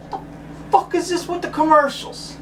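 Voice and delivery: high, strained and breathy disbelief; exasperated disbelief. Retold or imitated. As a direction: squeeze out the question, fed up with ads. Quoting his own frustration.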